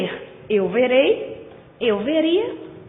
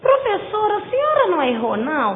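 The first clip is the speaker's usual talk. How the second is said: higher-pitched pupil querying the teacher; quick, high imitation of a student asking; student's question; 7 semitones higher than usual. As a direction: pipe up like a student doubting the teacher. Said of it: "tem essa pergunta".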